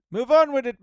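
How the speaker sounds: high, loud self-scolding yell; mock impatient shout. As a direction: yell at yourself to get going. Mock-scolds himself to get moving.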